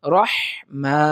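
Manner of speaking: "রহমা" with heavy rounded "ro" and strong h; correct tajweed. Shown as a demonstration. Teacher modelling the word, careful tajweed. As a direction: hold the breathed h and long "maa" in "রহমা". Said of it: "সুতরাং সহি হলো".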